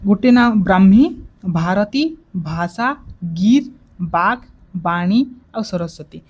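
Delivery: each name said apart with a pause, Brahmi loud, Gir and Bag split; names counted out. Shown as a demonstration. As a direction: count the names off one by one, slowly. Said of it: "ସାତ ଗୋଟି ନାଁ ଅଛି".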